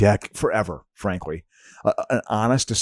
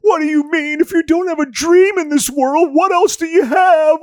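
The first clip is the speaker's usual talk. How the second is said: hammed-up high-pitched wail; mock-tearful motherly outcry; upset mother; 6 dB louder than usual. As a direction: play a distraught mom, loud and very high, dragging out the last words. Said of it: "mothers were just upset".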